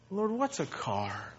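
soft, slow, shrugging "what's a car"; light carefree prayer. Retold or imitated. Preacher voicing a contented believer to the Lord, soft and slow. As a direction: let go of the loss with a gentle smile.

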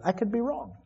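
quiet humble concession; soft admission. Real, not acted. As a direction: concede gently. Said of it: "I already said tonight".

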